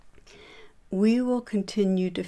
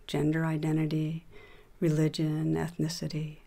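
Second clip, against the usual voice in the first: calm, even voice with long pauses between phrases; guided meditation; 4 dB quieter than usual. Acted. Teacher leading listeners through a meditation on shame, guided meditation voice. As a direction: guide them gently inward, leaving room to settle.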